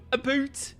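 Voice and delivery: high-pitched mock Canadian; badly faked Canadian vowels; attempted Canadian accent. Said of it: "I'm not doing that".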